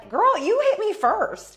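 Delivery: very high, loud and slow; outraged yell. Real, not acted. To the dancer who lies about being hit, a very high loud slow yell. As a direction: shout it back, furious.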